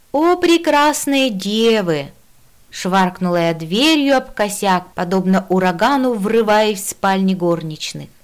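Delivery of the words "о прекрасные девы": slow, higher, theatrical salutation; grand greeting. Acted. Jester bursting in on the maids, grandly declaiming. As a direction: hail them grandly, stretching every word.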